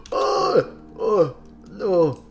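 ordinary delivery

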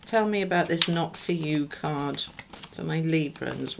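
ordinary delivery

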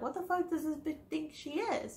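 mock the coworker's inner sneer, hushed; imagined scornful thought. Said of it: "thinking to herself".

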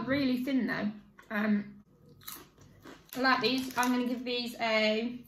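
speaking between bites; chewing while talking. Real, not acted. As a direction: mouth half full of crisps. Seen 3: Bites and chews crisps between words throughout; the R frames show no eating.

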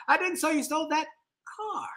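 pause, then "car" set apart at the end; stress on CAR. Shown as a demonstration. Last inflection demo for the class, stress on CAR. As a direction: hold back, then land on "car" alone. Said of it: "put the inflection on a different word".

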